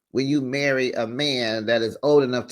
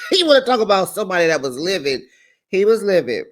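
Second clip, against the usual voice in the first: fast, loud, laughing disbelief; cracking up at him being livid; 5 semitones higher, 5 dB louder, faster than usual. Real, not acted. Laughing that the husband was livid.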